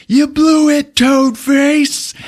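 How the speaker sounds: high, loud gloating shout; hammed-up jeer at a beaten enemy; taunting mock yell. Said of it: "Dennis is fucking laughing".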